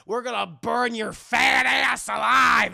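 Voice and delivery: loud, slow threatening yell; menacing threat shout. Retold or imitated. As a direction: threaten to burn him alive. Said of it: "thugs threatening the captive".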